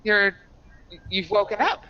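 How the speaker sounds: surprised, flustered maid; mildly surprised maid; startled maid. Acted. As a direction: gasp in surprise. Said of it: "She says".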